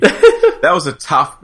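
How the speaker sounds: slow words broken by laughter; laughing. Real, not acted. Recalling a hard game, laughing.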